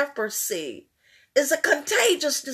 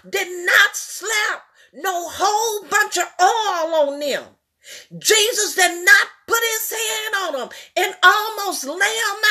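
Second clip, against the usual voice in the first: higher, raised preaching voice; fiery preaching; 6 semitones higher than usual. Acted. Preacher telling the church Jesus used no oil, fired up. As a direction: drive the point home to the church.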